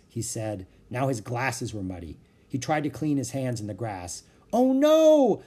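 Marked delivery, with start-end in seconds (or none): 4.5-5.4 high, loud cry of dismay; alarmed shout. A narrator's reading. Annie seeing the wildebeest coming, alarmed shout. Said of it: "shouted Annie".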